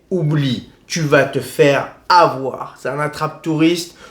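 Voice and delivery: normal talking voice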